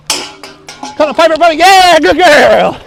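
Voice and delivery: excited dog praise. Real, not acted.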